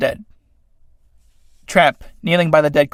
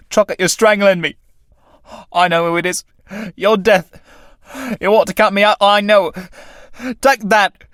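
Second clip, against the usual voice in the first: raised, strained defiance building; desperate struggle; 5 semitones higher, 3 dB louder than usual. Acted. Tramp wrestling death for his life, loud defiance. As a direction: fight back loud and high.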